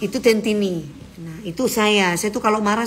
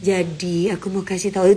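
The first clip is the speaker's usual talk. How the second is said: mock gentle talk; 2 semitones lower, slower than usual. Shown as a demonstration. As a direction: pretend to be gently coaxing, not your real manner. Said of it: "itu nggak bisa ya". (pura-pura lembut)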